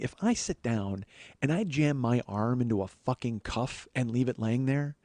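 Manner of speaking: normal talking voice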